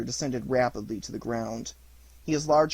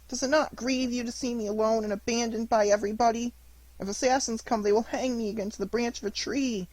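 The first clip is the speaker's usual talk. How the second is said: put-on high, pleading boy's voice full of grief; a lost little puppet begging his dead fairy to come back; weeping puppet boy; 10 semitones higher, 5 dB louder than usual. Pinocchio weeps at fairy's grave.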